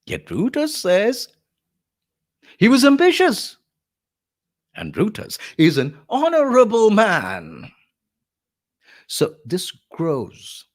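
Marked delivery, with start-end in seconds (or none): 0.1-7.7 high, loud, growing sarcasm; rasping sarcasm. Acted. Lecturer as Antony turning on Brutus, rasping sarcasm. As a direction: hammer the praise mockingly, pitched right up. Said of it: "this sarcasm and the rasping delivery".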